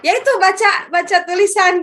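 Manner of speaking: a teacher shrugging off the question; teacher's clueless answer. Retold or imitated. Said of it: "katanya kan".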